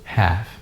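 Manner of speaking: "Half" with a silent L, as haf; teacher modeling the correct "Half"; model pronunciation. Shown as a demonstration. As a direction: drop the L in "Half". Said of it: "something like this".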